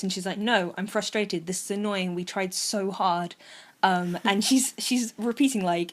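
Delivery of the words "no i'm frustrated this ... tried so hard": ordinary talk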